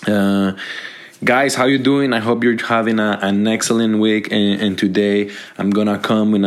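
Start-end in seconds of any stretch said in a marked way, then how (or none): none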